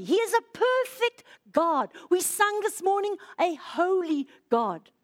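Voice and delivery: ordinary delivery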